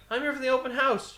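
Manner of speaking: high, chirpy mock-innocent voice; mock innocent reply. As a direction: play dumb, sweetly high. His innocent reply retold.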